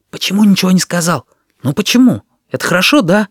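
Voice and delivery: high, urgent anxious questions; anxious questioning. A narrator's reading. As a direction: press for an answer, worried and high-pitched. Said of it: "тревожным шёпотом допытывался". (тревожные, настойчивые расспросы высоким голосом)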